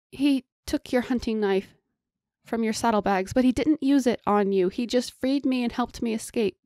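high, insistent appeal; pleading captive girl; urgent pleading. Acted. Pleading for friend's life.

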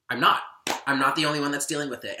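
ordinary delivery

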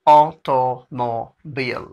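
Automobile slowly, syllable by syllable; slow demonstration. Shown as a demonstration. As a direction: separate automobile into "aw, to, mo, beel". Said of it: "slow कहेंगे".

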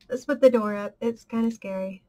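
normal talking voice

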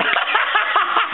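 high burst of laughter; laughing. Real, not acted. Blogger done ranting about Bell, laughing. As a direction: crack up at your own rant. Laughs at his own blog.

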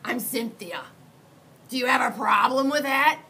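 offended shout. Acted. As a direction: dare him to object. Girl monster takes offence.

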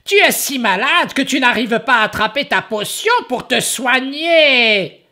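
high, fussy ogress; caring high-voiced ogress. Ogress fussing over Horace, high.

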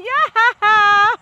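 high, loud mock horse voice; voicing the horse. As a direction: answer for the horse in a squeaky voice. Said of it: "He's like".

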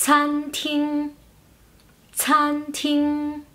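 "cantín" as slow tsan-ting with puffed ts; aspirated C. Shown as a demonstration. Teacher giving a word with C, "cantín" said tsan-ting. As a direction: stretch "cantín" level, starting ts, not k. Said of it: "Una palabra que se escribe con C".

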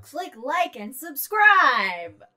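slow, loud mock YouTuber sign-off; hammed-up YouTuber outro. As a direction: drag it out like a cheesy YouTuber.

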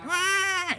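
high-pitched mock wail; imitated child's cry. Child crying during the hymn, high mock wail. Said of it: "that they may cry".

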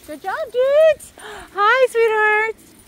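high, drawn-out dog-praising voice; gushing pet greeting. Real, not acted. Walker greets her sweethearts, high gushing praise. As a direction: greet the dogs in a high coo.

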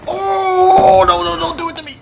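high, loud pleading panic; high frantic shouted plea; panicked cry. Real, not acted. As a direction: beg not to be killed. Panicking under attack.

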